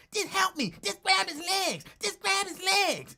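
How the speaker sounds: high, loud panicked order to grab the legs; mock frantic order. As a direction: shout it up high, desperate for backup. Lincoln pleading during fight.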